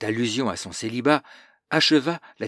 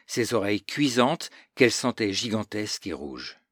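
ordinary delivery, nothing marked

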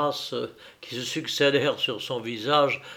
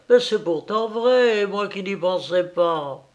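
higher farmwife's exclamation of realization; exclaiming; 6 semitones higher, 4 dB louder than usual. A narrator's reading. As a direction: exclaim as if it just dawned on you. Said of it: "s'écria la fermière". (fermière qui s'exclame, frappée par l'idée)

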